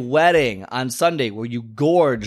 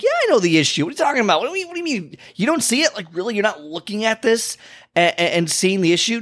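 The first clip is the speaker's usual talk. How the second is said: incredulous disbelief; 7 semitones higher, faster than usual. Retold or imitated. Coach retold, incredulous.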